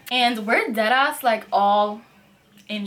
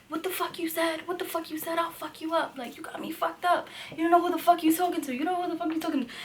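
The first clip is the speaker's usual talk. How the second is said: higher, mock-menacing threats in his face; imitating roommate's threat; 6 semitones higher, 6 dB quieter, faster than usual. Roommate in the guest's face, higher mock threat. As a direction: play the roommate squaring up, voice pitched up. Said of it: "my roommate, is, like, in his face".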